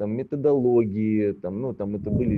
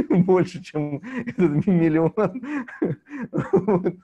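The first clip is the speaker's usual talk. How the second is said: higher, louder, breaking into laughter; laughing; 9 semitones higher, 5 dB louder, slower than usual. Real, not acted. Guest telling how they lost more than earned, laughing. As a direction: laugh helplessly through the words.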